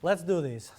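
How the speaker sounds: normal talking voice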